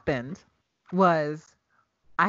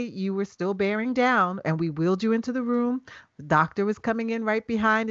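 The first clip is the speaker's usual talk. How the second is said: ordinary delivery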